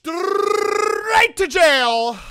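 drawn-out, high, loud proclamation with rolled R; mock outraged verdict. Streamer condemns parents who film their kids, mock outraged shout. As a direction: draw it out like a shouted sentencing.

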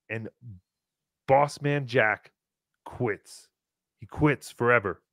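slow, low, dropping away; mock-solemn announcement. Host announcing the gambler quits forever, mock-solemn. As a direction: announce it gravely with drawn-out pauses.

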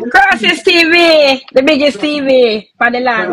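slow, loud announcer hype; stretched-out radio ad call; loud brand shout-out. Acted. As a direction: boom out the shop's name like an ad.

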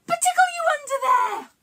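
spring the tickle with a squeal; playful tickle squeal. Pretend. Presenter pouncing on the tickle line for children, high excited squeal.

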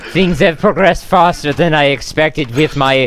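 mock-Russian diary voice; terrible Russian accent. Player resuming survival diary after a laugh, bad Russian accent. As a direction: narrate in a badly faked Russian accent. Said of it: "talking in terrible Russian accent again".

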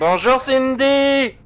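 high, loud, drawn-out mock radio greeting; ear-splitting shout. Streamer yelling hello to the radio's weather presenter, ear-splitting shout. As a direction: stretch the greeting into a piercing holler. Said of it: "Désolé pour vos oreilles".

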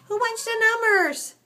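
very high pet voice. Real, not acted. Feeding messy kittens, high-pitched baby talk. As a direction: squeal it sweetly to little animals.

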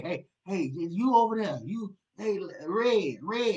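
loud, high shouting across a room; mock calling out. God calling a stranger by a nickname, mock loud. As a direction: holler like you don't know their name. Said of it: "I ain't just calling you".

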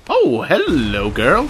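slow, loud, drawn-out hello; delighted greeting. Real, not acted. Gamer greeting the little girl character, loud and drawn out. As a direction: greet her with big delight.

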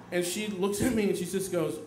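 usual speaking voice

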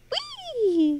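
high gleeful whee; excited whee. Real, not acted. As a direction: squeal it as you jump. Jumping down happily.

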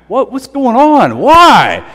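exaggerated despairing cry; anguished yell. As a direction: hurl the question at God. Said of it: "Job is saying".

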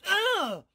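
sudden high cry of pain as the tongue gets caught; pained yelp. Acted. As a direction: yelp like your tongue is stuck.

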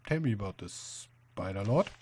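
ordinary delivery

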